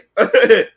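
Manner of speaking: short loud laugh; loud burst of laughter. Real, not acted. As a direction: laugh out at the memory. Laughs at memory.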